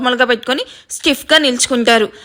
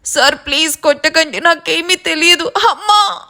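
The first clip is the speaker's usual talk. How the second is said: rising, high cries of pain; pained begging; 4 semitones higher than usual. A narrator's reading. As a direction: plead between blows. Begging while being beaten.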